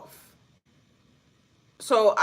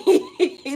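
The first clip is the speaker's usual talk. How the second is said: high burst of laughter; real laugh; 8 semitones higher, 3 dB louder than usual. Real, not acted. Laughing at her own joke to viewers, high laugh. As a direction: crack up in a high giggle.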